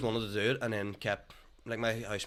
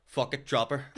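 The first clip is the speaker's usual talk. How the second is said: loud, higher mock-aggressive dismissal; 4 semitones higher, 6 dB louder than usual. Imitating a comedian's blunt advice to a caller, mock aggressive. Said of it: "just aggressive".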